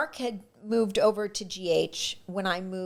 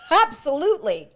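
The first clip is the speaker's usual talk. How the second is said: high, loud eager yes; 8 semitones higher, 6 dB louder than usual. Retold or imitated. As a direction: jump at the offer. Eagerly accepting French accent job.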